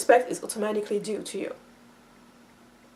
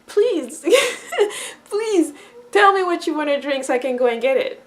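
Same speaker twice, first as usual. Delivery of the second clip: pleading to help; 3 semitones higher than usual. Retold or imitated. Children asking to fetch your drink, eagerly pleading. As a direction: imitate a child begging to serve, easing off after. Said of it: "they will ask you".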